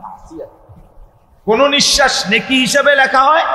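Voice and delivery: high, loud, emphatic sermon delivery; full-force preaching. Acted. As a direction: drive each point home to the congregation at full voice. (উঁচু গলায় জোরালো ওয়াজ)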